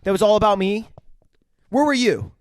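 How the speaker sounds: guilt-trip her like a spoiled jock; hammed-up whiny boyfriend; mock whining rant. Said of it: "S1 joins acting Troy's rant".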